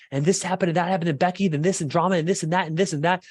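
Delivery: high, breathless run-on venting; chattering woman. Retold or imitated. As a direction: imitate a wife spilling drama item by item. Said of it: "they're just kinda like".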